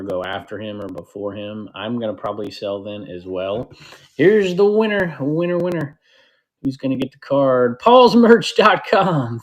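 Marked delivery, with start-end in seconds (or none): 4.2-9.4 high, loud call-out of a winner; excited winner announcement. Real, not acted. Host calls out who gets the card, excited announcement. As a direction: announce the prize winner, stretching the name out.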